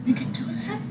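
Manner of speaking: put-on high, breathy six-year-old asking; high childlike wonder; little kid voice. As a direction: squeak it out like a wide-eyed little kid. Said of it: "and I'm like".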